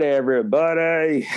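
usual speaking voice

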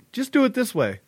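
high, commanding lilt; high bossy voice. Retold or imitated. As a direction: order it briskly, up high. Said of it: "she's like".

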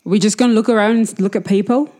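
lightly voiced impatient drinking companion; drinker in character. Drinker asks old man what they are doing, loud in-character challenge. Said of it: "So she says to the old man".

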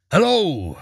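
an understated storybook roar; mild roar. A narrator's reading. Half a man greeting Eric from the floor, mildly roaring. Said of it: "roared the man".

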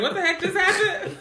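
high, loud, laughing disbelief; mock shock. Retelling surprise at unemotional friend crying, mock-shocked. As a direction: re-enact being stunned, voice shooting up.

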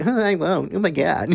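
high, flustered mock promise; hammed-up giggly reply; giggly girl. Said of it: "And she's like".

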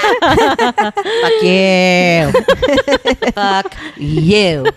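high, laughing through the words; laughing curse. Real, not acted. Joking at the company between hosts, laughing hard. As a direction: toss it off while cracking up.

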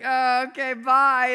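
high, loud mock farewell; embarrassed exit. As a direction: act out a mortified kid fleeing the room. Acts embarrassed exit from party.

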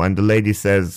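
ordinary talk, nothing marked

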